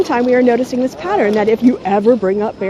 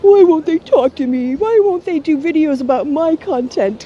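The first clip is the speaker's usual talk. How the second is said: higher, whiny imitation of complainers; exaggerated poor-me whine, making fun of them; mock whining; 3 semitones higher than usual. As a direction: mimic them whining and complaining, pitched up and pushed. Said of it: "anti-S J Ws are whining".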